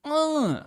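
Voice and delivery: high, held imitation of a cat's moan; cat yowl imitation. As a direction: try out a drawn-out cat noise. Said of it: "the sound a cat makes".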